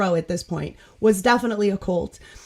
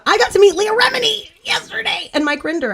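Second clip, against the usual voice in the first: thrilled, high-pitched burst; excited announcement; 11 semitones higher, 4 dB louder than usual. Real, not acted. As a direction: blurt it out like big fan news. Excited telling of proudest moment.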